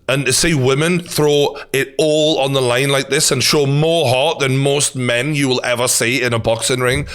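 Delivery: normal talking voice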